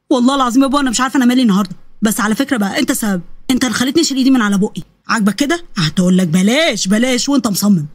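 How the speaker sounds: defensive son. Acted. (ابن بيدافع عن نفسه بصوت أوطى)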